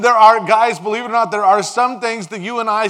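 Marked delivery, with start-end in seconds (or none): none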